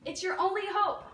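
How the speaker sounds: loud, high urgent shout; crying out. Acted. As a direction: plead from above over the noise. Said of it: "they cried".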